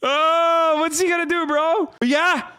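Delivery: high, excited mock-boastful rant; hammed-up patriot boasting about his musket; mock gun nut.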